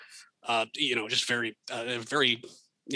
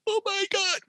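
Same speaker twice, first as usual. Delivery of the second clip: much higher, a hammed-up groan of disbelief; mock dismay. Realising the coworker tricked him at a party, hammed-up higher dismay. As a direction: play the dawning horror of being fooled. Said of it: "it was just like".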